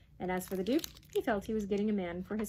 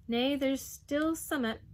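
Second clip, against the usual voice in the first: slow, higher objection; protesting; 6 semitones higher, 4 dB louder, slower than usual. A narrator's reading. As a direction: hold things up with a drawn-out protest. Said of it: "Missus Carraclaw protested".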